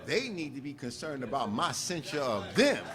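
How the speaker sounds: unbothered, even reply to the Senate; calm. Retold or imitated. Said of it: "he would just calmly say".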